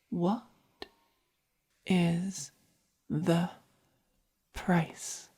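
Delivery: word by word, long pauses between; pointed demand. Acted. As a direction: spell it out slowly, one word at a time.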